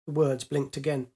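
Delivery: ordinary delivery, nothing marked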